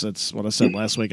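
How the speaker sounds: normal talking voice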